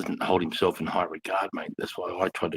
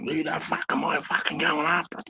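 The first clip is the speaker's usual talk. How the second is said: much higher, mock fired-up outburst; imitating Dale, fired up; 11 semitones higher than usual. Dale rushing over ready to fight, mock fired-up high yell. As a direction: throw it up high like a mate itching for a fight. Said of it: "ready to fucking".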